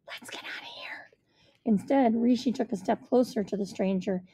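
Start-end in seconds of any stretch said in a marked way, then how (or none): 0.1-1.1 hushed unvoiced whisper. A narrator's reading. Narrator whispering to Rishi to flee, hushed. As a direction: breathe it so the strangers can't hear. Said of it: "I turned to Rishi and whispered".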